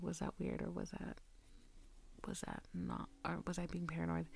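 a soft, hushed run of self-doubt. Retold or imitated. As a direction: doubt yourself under your breath. Second-guessing herself in a hush.

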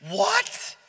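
high, loud panicked yell; screaming. Acted. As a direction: scream it inside your head in alarm. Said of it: "screaming at the top of his lungs".

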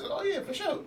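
high, airy dismissive yeah; mock breezy reply. Imitating his friend's breezy brush-off. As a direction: toss it off like it's nothing.

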